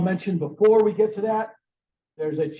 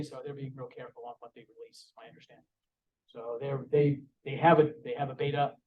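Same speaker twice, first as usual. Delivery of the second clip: usual speaking voice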